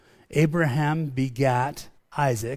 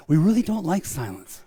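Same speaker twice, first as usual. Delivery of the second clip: ordinary talk, nothing marked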